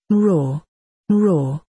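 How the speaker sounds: lightly voiced hoarse cat roar; a hint of a cat growling from the throat; hoarse throaty cat. Old cat greeting the boy from behind, mild throaty roar. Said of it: "a hoarse throaty voice said".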